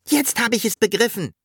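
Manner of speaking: high, quick realisation; Sams catching on. A narrator's reading. Sams to the dragon, high quick realisation.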